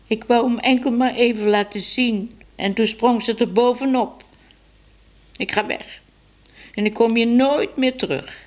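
high-pitched tearful complaint; sobbing child. A narrator's reading. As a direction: protest like a hurt little girl near tears. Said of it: "snikte ze".

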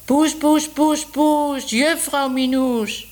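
high, drawn-out call across the rooftops; calling from afar. Acted. Man calling Minoes over the rooftops, high and drawn out. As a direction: call the cat from far away, singsong. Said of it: "riep de stem".

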